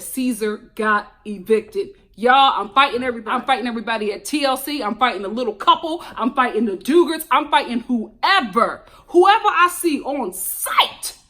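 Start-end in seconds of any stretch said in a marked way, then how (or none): 2.2-11.2 comic tirade, last words stretched and punched; mock fighting rant. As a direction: threaten everyone in hammed-up outrage. Mock threat to fight the network.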